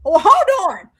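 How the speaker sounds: loud, high, near-crying disbelief; loud, high, broken protest at being compared; incredulous outcry. Retold or imitated. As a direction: cry out in shocked protest. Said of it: "I say".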